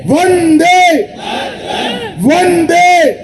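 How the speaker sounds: slow, high, roaring chant; roaring rallying speech. Real, not acted. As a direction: roar each word to the crowd. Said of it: "और प्रचंड आवाज से बोलिए".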